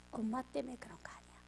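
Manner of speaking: hushed, tearful whisper; whispered confession. Retold or imitated. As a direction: breathe it out near tears. 딸의 말을 다시 속삭임. (울음을 참으며 속삭이듯)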